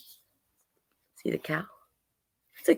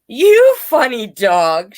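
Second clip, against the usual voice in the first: loud, slow, higher bird voice; amused bird; 5 semitones higher, 8 dB louder, slower than usual. A narrator's reading. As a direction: draw it out loudly, teasing the dog. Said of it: "The bird burst out laughing".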